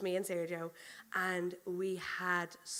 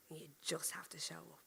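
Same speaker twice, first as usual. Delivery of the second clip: whispered; 4 semitones lower, 11 dB quieter than usual. Acted. Preacher drives home the point.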